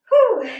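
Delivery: high breathy exhale after effort; winded whew. Real, not acted. Trainer to viewers, a winded whew. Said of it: "I am definitely winded now".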